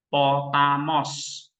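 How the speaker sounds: slow model reading. Shown as a demonstration. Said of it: "Berarti berapa suku kata?".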